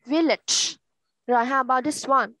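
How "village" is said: "Village" ending on a released ch; final ch pronounced. Shown as a demonstration. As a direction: model "Village" with a clear closing ch. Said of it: "Đọc là ch".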